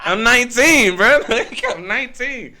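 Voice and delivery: loud, high, laughing admission; laughing exclamation. Real, not acted. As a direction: blurt it out loudly through laughter. Laughing plea about his real age.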